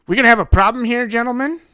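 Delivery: loud, fast, high-pitched confrontation; aggressive challenge. Acted. Detective staring down onlookers, loud aggressive challenge. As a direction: throw it at them as a loud threat.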